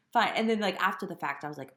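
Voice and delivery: ordinary talk, nothing marked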